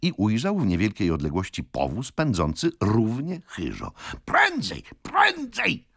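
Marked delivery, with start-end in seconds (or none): 4.2-5.9 very high, urgent yell; frantic old gentleman shouting. A narrator's reading. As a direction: scream at the driver to speed up. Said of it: "wrzeszczał stary gentleman".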